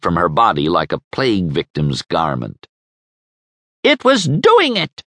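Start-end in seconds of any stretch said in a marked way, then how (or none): 3.8-5.0 high, loud shriek of accusation; screamed. A narrator's reading. Neighbour shrieking at Krieger about the cat. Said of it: "she screamed at him".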